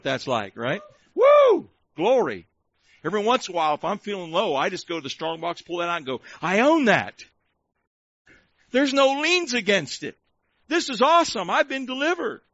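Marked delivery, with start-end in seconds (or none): 1.1-1.7 high, loud "woo" of celebration; excited whoop. Real, not acted. Preacher celebrating a paid-off car, excited whoop. Cheers over being debt-free. 6.4-12.4 acted delight, pitched up; triumphant glee. Preacher acting out owning his car outright, triumphant glee. Acts out rejoicing over car title.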